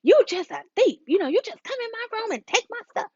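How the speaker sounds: high, mock-accusing parent. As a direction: call the kid a thief with mock outrage. Parent accusing kid of stealing.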